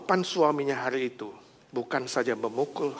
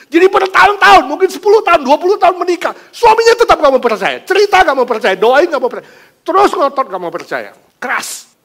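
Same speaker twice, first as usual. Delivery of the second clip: much higher, loud insistent sermon; loud fervent preaching; 15 dB louder than usual. Acted. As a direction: hammer it home from the pulpit. Preaching at full force.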